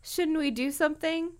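loud, high, hammed-up fright with laughter; scared wail. A narrator's reading. Dina frets to Tracy about Brenda, hammed-up scared. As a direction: burst out in overdone panic. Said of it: "Dina uttered a soft moan".

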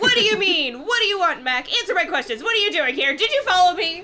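high, loud barrage of questions; hammed-up frantic interrogation at full voice; cried out. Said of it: "I cried".

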